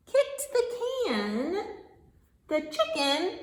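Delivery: animated storytime narration with big swells; reading to children. Acted. Reader telling a farm story to small children, animated storytime voice. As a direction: stretch out key words for little listeners.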